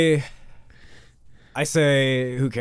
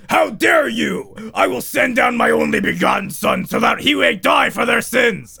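high, loud, slow mock outrage; God shouts at Lucifer in hammed-up fury; outraged God; 9 semitones higher, 4 dB louder than usual. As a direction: shout it like a wounded deity. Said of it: "And God's like".

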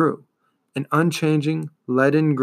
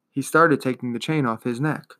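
ordinary delivery

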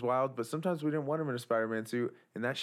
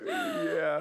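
very high crying-laugh yeah; laughing squeal; 3 dB louder than usual. Real, not acted.

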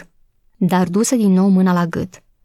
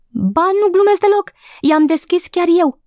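much higher, eager protest; excited insistence; 11 semitones higher than usual. Acted. Maid insists to the gardener that she isn't joking, excited. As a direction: insist it's true, all worked up.

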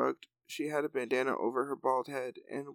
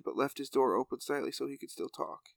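usual speaking voice

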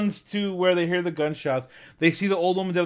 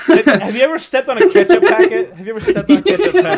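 rising into a laughing, faster repeat; excited laughing question; 10 semitones higher, 9 dB louder, faster than usual. Real, not acted. Asking co-hosts, laughing and excited. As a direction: pushes the question louder and higher through laughter.

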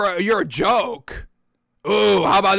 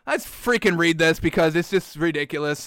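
ordinary talk

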